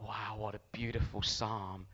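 slow, soft admiring murmur; hushed awe. Retold or imitated. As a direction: marvel quietly at what you read. Repeats his awed reaction.

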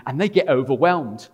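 loud, high preaching build; rising excitement in the telling. Acted.

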